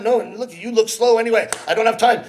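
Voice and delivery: ordinary talk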